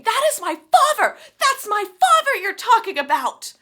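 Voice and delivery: high furious yell; screaming. Retold or imitated. Tris attacks Molly over her father: furious scream. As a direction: scream it, defending your father.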